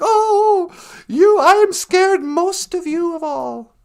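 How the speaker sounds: high, slow, hammed-up dread; mock freaking out. Scrooge faces the last ghost, mock freaking out. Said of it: "old Scrooge is just freaking out".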